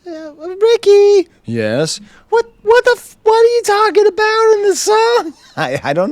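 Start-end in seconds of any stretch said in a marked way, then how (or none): 0.0-1.3 much higher, louder put-on voice, slow and drawn out; hammed-up squeaky caller hailing Ricky; a cartoon fan yelling across the room; mock fan calling out. Mock interviewer calls Rick. 2.3-5.3 hammed-up squeaky caller hailing Ricky; a cartoon fan yelling across the room; mock fan calling out. Mock interviewer grills Rick.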